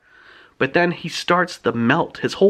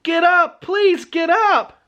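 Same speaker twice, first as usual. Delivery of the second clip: acted boy's yell, pitched way up; pleading yell; 11 semitones higher than usual. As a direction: beg someone to get up in a high, stretched cry. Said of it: "Skipper's yelling".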